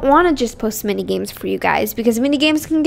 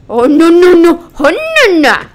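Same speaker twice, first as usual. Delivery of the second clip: panicked no; 3 semitones higher, 6 dB louder than usual. Real, not acted. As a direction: yelp no in alarm. Game panic.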